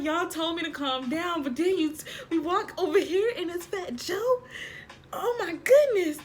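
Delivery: high, loud starstruck disbelief; overexcited protest. Retold or imitated. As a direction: protest as if completely overwhelmed. Acted fan protest on seeing star.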